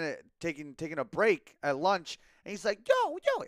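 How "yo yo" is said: squeaky-high falsetto greeting; Lewis's high-pitched voice. Retold or imitated. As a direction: imitate a guy with a very high voice. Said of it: "a really high-pitched voice".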